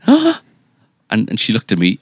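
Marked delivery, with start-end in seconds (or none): 0.1-0.4 high, loud gasp of amazement; imitated gasp. Wife seeing the gift for the first time, gasping. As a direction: mimic her sudden high gasp. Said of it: "and she went".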